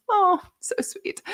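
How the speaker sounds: touched to tears by a kind message; teary. Real, not acted. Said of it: "Angela, I'm in tears".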